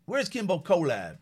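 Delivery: normal talking voice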